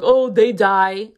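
slow, drawn-out whiny mock of flower haters; mocking whine. She mocks people who dislike flowers, slow whiny parody. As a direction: parody the complainers, stretched out.

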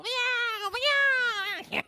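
high squeaky kitten mewing; kitten meows. Retold or imitated. Pastor mimics kittens getting their eye drops, high squeaky meows. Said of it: "so they go".

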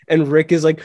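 ordinary talk

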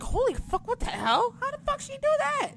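high, stunned squeal of disbelief; high astonished squeal; shocked disbelief. Retold or imitated. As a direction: gasp it out, way up high, baffled. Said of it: "I was just like".

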